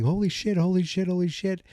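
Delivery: high-pitched mock panic; freaking out. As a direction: lightly act out freaking out, repeating it in a high voice. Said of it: "freaking out".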